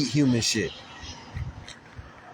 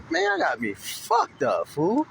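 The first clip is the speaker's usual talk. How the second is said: higher, slowed, disbelieving outrage; outraged; 8 semitones higher, slower than usual. Real, not acted. Cyclist billed after being hit, high outraged scoff. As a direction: scoff at the bill you shouldn't owe.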